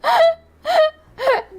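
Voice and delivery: real high-pitched helpless laughter through the words; cracking up. Real, not acted. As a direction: barely get it out for laughing. Cracks up at accent clip.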